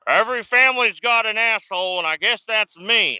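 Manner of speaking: high, loud put-on voice of a crass old man; mocking the great uncle. Nephew mocking his bigoted great uncle, high loud put-on voice. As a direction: ham up the proud family asshole. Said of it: "He's like".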